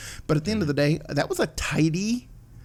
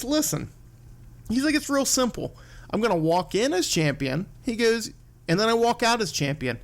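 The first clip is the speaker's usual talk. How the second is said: imitated smug calm, higher pitch; smiling confidence; 7 semitones higher than usual. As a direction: retell it with a smile, pitched up. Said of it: "Brock's just smiling".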